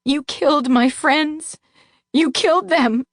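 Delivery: high, sobbing accusation; false sobbing. A narrator's reading. Captive narrator pretending grief at the Duke, sobbing.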